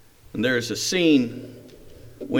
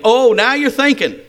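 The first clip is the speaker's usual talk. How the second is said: higher, louder sarcastic coach; mocking coach; 8 semitones higher, 5 dB louder than usual. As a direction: sneer the line like a tough coach. Coach mocks player in story.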